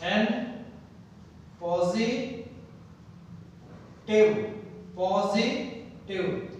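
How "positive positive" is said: positive broken as posi, long pause, tive; split into syllables. Shown as a demonstration. Teacher demonstrating fragmentation to learners, split into syllables. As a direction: say positive slowly and softly, holding back the final tive. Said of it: "this is the fragmentation of the word".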